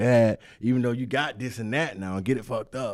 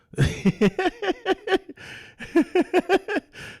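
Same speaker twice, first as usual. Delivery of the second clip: sudden high-pitched burst of laughter; 6 dB louder than usual. Real, not acted. Co-host laughing at his friend's Jesus talk, high burst of laughter. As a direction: let out a squealing laugh.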